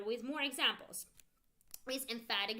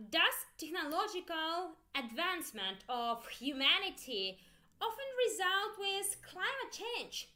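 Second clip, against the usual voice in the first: ordinary delivery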